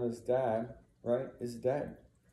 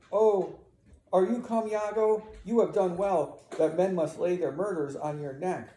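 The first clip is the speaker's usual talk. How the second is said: high, raised accusing greeting; bitter accusation; 6 semitones higher, 4 dB louder than usual. Acted. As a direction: confront the husband as he arrives. Emilia confronts her husband Iago.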